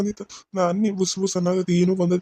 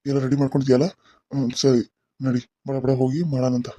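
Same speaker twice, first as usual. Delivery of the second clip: much lower put-on male doctor voice; man's voice; 8 semitones lower than usual. Disguised woman posing as the doctor speaks in a much lower man's voice. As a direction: drop the voice low to pass as the male doctor. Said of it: "ಅವ್ನ ಗತಿ ನಾನು ಮಾತಾಡ್ಬೇಕು".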